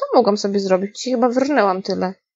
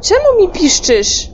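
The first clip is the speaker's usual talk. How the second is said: frustrated yelling; 2 semitones higher, 5 dB louder than usual. Real, not acted. Gamer yelling at the squealing detector, exasperated. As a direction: scold the gadget at full volume.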